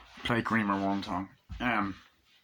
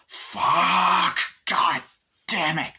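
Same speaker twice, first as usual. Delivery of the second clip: mock cursing; 7 semitones higher, 4 dB louder, much slower than usual. Character cursing in frustration.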